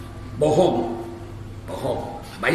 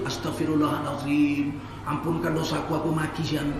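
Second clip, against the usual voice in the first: quiet remorse; 4 semitones lower, 6 dB quieter than usual. Retold or imitated. Night-time remorse after cursing someone.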